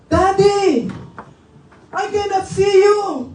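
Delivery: high, loud cry to Daddy; child calling out. Acted. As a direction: call out desperately as the trapped son. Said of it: "At sabi ng anak". (kunwaring sigaw ng batang naiipit)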